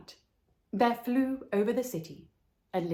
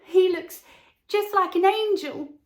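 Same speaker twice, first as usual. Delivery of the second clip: put-on much higher and louder, childlike wonder; children's voices; 10 semitones higher, 7 dB louder than usual. Charity children leaving cathedral, awed and high-pitched. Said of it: "said the charity children".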